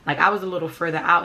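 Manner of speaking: ordinary delivery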